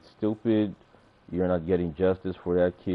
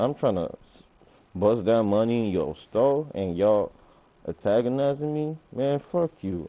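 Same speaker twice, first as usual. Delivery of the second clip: usual speaking voice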